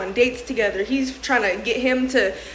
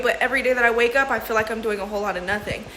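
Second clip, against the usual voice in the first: normal talking voice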